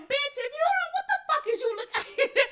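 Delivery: high-pitched mock outrage; cussing someone out. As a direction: play at telling someone off, high and incredulous. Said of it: "She'll fuck around and get cussed out".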